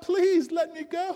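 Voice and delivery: tiny high voice, begging with a rising end; trapped creature asking to be let go; high pleading plea, rising; pleading little voice. A narrator's reading. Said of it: "there's this voice saying".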